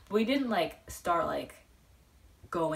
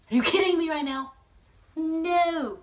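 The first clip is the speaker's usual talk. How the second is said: exasperated disbelief; 10 semitones higher, 7 dB louder than usual. Retold or imitated. Bummed fan hears meet cancelled.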